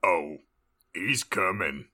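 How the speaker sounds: lightly voiced old-timer; Wilford Brimley impression. Sirius answering the heroine, light Brimley impression. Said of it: "we're just going Brimley".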